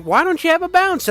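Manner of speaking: high mock-seductive plant voice; flirty plant voice. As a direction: tease him like a flirty flower. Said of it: "she's like".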